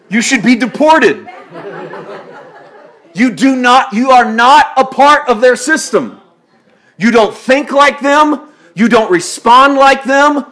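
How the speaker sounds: loud, high, driving sermon climax; full-force preaching. Acted. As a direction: hammer each line home to the congregation.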